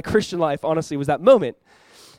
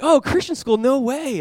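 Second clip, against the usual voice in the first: high-pitched impressed reaction; imitated excited amazement; delighted surprise; 7 semitones higher, slower than usual. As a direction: react as if thrilled by the news. Retold delighted reaction of player.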